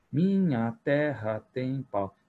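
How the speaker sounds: slow chant of "Minha terra tem pau", strong and weak syllables alternating; strong weak beats. Shown as a demonstration. Teacher scanning the poem's rhythm for the class, slow stressed beats. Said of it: "Ele fica forte, fraca, forte, fraca".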